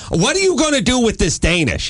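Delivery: high-pitched retold challenge to the wife; exasperated demand. Husband confronting his wife over untouched pastries, exasperated. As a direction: demand an answer, pitch jumping up.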